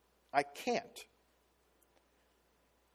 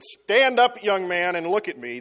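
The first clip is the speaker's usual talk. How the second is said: stern dad; 2 semitones higher, 7 dB louder than usual. Retold or imitated. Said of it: "that means we're in trouble".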